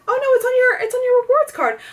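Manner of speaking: very high, mock-innocent saleslady; high-pitched mocking impression of a cashier; mimicked cashier. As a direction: imitate a clerk brushing off a concern.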